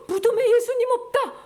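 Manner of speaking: high, loud cry of alarm; gasp, then cry out in shock; acted panic at a missing body; shocked discovery. Mary finds the tomb empty.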